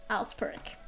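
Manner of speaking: normal talking voice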